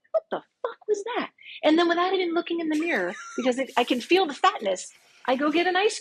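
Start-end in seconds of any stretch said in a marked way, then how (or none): 0.1-1.3 high, cracking cry of disbelief; stunned outburst. Retold or imitated. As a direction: blurt it out to the empty house, stunned. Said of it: "out loud, I go".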